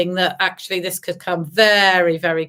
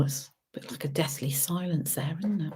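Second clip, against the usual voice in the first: whisper; 4 semitones lower, 13 dB quieter than usual. Acted. Teasing about silence.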